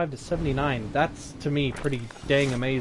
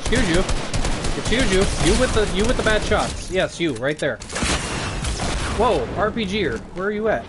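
loud, higher mock-offended taunt; sassy loud callout; 5 semitones higher, 6 dB louder than usual. Real, not acted. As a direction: tell the bad shooter off with sass. Taunting enemy loudly.

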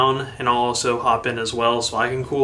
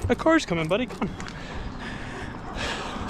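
higher-pitched call to a dog; 6 semitones higher, 8 dB quieter, much slower than usual. Real, not acted. Runner warning the dog about a car, calling higher. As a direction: urge the dog along, pitched up.

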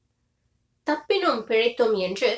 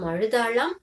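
ordinary delivery, nothing marked

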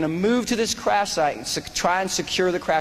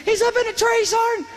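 yelling. Retold or imitated. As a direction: yell it across the street over gunfire. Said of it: "Floyd starts yelling".

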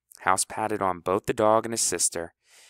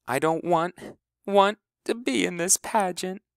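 high, broken tearful plea; hiccuping sob; 11 semitones higher than usual. A narrator's reading. Honey tearfully pleading with her brother House to get out of the pageant, hiccuping. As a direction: push the words out between hiccups. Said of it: "in a jagged little hiccup".

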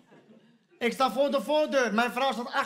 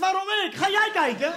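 mock protest; 10 semitones higher, 5 dB louder than usual. Husband protesting, higher and laughing.

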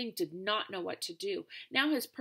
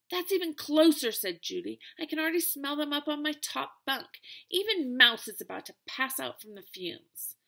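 higher and louder, fed-up big sister; louder complaining sister; 5 semitones higher, 4 dB louder than usual. Acted. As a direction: complain about the stink, raising the voice.

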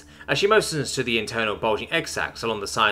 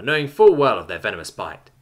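usual speaking voice

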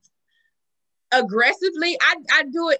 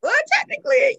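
sudden high-pitched exclaim; excited high exclamation; 12 semitones higher than usual. Real, not acted. Exclaiming in lively talk.

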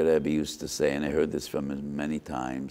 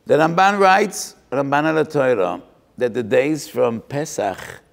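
fiery preaching; 8 semitones higher, 8 dB louder than usual. Acted. Preacher quoting the Ramban.

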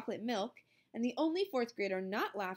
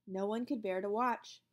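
ordinary delivery, nothing marked

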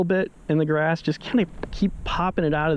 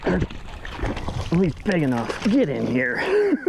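real thrill of landing a big fish; excited catch; 5 semitones higher, slower than usual. Real, not acted. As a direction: pitch jumps high as the fish comes in, drawing out get in here.

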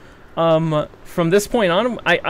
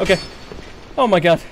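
usual speaking voice

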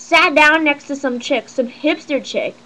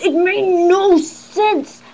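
"no sense" stretched out and raised in pitch; exasperated emphasis; 5 semitones higher, much slower than usual. Real, not acted. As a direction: drag out NO SENSE, very slow. Exasperated at his chatter.

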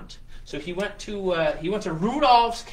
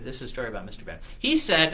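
plain reading voice

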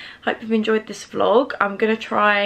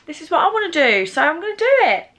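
high, determined self-quote; excited; 9 semitones higher, 3 dB louder than usual. Retold or imitated. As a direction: announce it with eager resolve. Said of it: "I'm like".

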